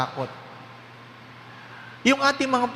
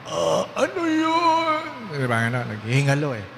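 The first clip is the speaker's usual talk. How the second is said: dying wife; 3 semitones lower than usual. Retold or imitated. Priest voicing the dying wife answering her husband. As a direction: let the words crack like someone near death. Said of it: "Sabi ng babae".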